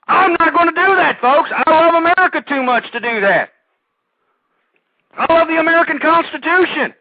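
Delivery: loud, high impassioned shout; screaming patriot. Real, not acted. Host refusing to tone down, shouting his love of America. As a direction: bellow your love of country. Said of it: "me screaming".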